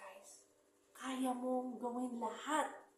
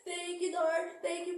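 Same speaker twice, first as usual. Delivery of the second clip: higher and louder, bursting with gratitude; excited thanks; 8 semitones higher, 7 dB louder than usual. Real, not acted. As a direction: cry out thanks to God at the top. Thanking God at the summit.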